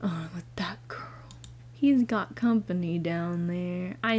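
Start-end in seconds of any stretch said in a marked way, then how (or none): 0.0-1.1 whisper. Real, not acted.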